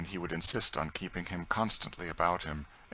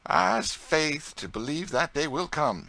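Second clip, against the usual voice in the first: slow, much higher, tearful earnest Tom; 9 semitones higher, 6 dB louder, slower than usual. Acted. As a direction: say it with tears and faith. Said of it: "said Tom earnestly".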